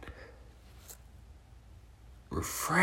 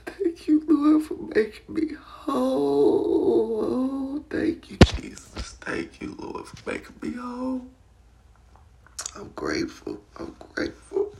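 whispered prayer; 7 semitones higher than usual. Real, not acted. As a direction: pray it under your breath, grateful.

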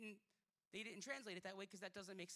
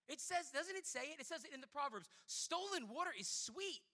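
raised, high, insistent sermon voice; full-force preaching; 7 semitones higher, 7 dB louder than usual. Real, not acted. Preacher quoting Proverbs to the church, forceful preaching. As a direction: proclaim the verse emphatically.

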